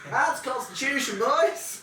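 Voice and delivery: high, loud game master's call; gleeful shout. Real, not acted. Game master calls a drinking check to players, gleeful shout. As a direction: belt it out like a rowdy announcement.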